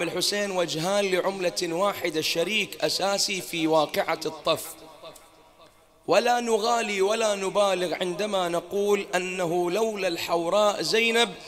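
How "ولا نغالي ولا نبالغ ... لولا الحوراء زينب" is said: full-force preaching. Acted. Preacher extolling Zaynab to the mourners, loud impassioned preaching. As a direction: preach it out to the hall with fervour.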